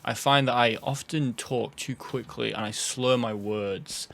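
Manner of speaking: normal talking voice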